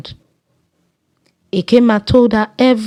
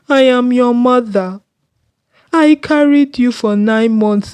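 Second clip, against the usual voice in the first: crying mother; 3 semitones higher than usual. Acted. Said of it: "she began to cry".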